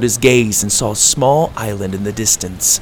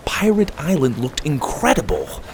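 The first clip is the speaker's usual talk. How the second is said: plain reading voice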